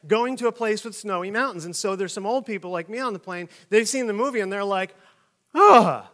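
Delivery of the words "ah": mock groan of dismay. Old passenger recoiling at video.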